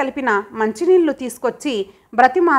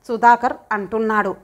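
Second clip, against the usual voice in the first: ordinary delivery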